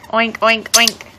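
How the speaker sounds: low, short playful oinks; pig oinks. Pretend. Mother making pig noises for baby, low playful oinks.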